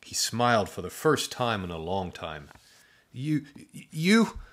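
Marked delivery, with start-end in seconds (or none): 3.1-4.4 stammering in awe, higher; awed stutter. A narrator's reading. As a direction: trip over the word in disbelief. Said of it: "Drew stuttered".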